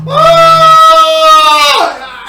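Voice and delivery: long high loud scream; drowning scream. Shown as a demonstration. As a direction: cry out as the water rises. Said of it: "think about those expressions".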